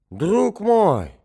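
high excited exclamation; loud high joyful cry. A narrator's reading. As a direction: call out with sudden joy. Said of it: "вскричал он".

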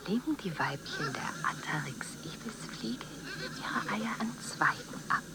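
hushed voiceless whisper, slowing at the end; creepy nature-film whisper; eerie whisper. Acted. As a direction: breathe it like a secret. Held whispered narration.

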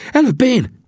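high, loud character voice; excited high-voiced insistence; insistent Sam. A narrator's reading. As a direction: push in first, pitched very high. Sam pushes friends aside.

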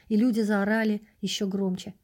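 plain reading voice

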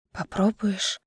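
soft, husky, worn-out question; quiet and husky. A narrator's reading. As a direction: ask it hoarse and barely audible. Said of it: "тихо и сипло спросила Чара".

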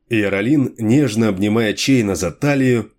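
usual speaking voice

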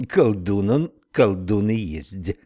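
slow drawled Irish brogue; drawled Irish accent. Acted. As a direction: draw it out in an Irish lilt. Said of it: "с непередаваемым ирландским прононсом протянула".

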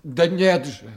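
sharp cry of protest; loud protest. Acted. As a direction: throw the denial back at him. Accused man protests innocence.

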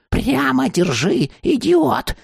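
put-on high, irritated old woman's bark; cross crone scolding; barked order. A narrator's reading. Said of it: "рявкнула она".

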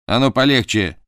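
loud warning bark; sharp shout. Acted. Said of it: "прикрикнул Джайлз".

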